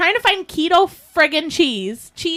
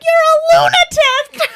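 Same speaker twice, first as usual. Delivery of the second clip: much higher, louder, slow mock shriek; Elaine's shout; 6 dB louder, slower than usual. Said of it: "she's like".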